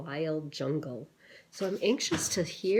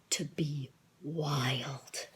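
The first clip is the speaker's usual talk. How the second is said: whisper; 2 semitones lower, 4 dB quieter, much slower than usual. A narrator's reading. Dramatic story moment.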